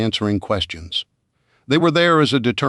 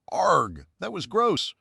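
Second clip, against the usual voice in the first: groaning; 9 semitones higher, 6 dB quieter, slower than usual. Acted. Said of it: "groaned Rita".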